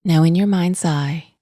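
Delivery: calm, unhurried meditation voice with long pauses; guided meditation. Acted. Podcast host guiding listeners to notice breath and body, calm meditation voice. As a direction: guide gently, in even, spacious phrases.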